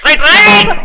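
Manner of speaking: high called-out signal; calling out. Acted. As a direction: call it out to the driver. Calling to the bus conductor.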